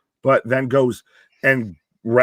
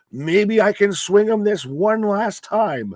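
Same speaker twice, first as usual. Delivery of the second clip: high, drawn-out wistful plea; slow, pleading, put-on hopefulness; mock teary hope; 9 semitones higher, 4 dB louder, slower than usual. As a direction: imitate a misty-eyed last attempt. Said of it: "a little bit of the tears and the misty eyes".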